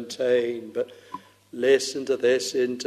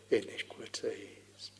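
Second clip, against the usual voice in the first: hushed whisper; 2 semitones lower, 15 dB quieter than usual. Acted. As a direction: fade into a whisper. End of Isaiah quote, hushed.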